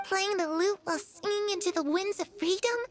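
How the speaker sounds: ordinary talk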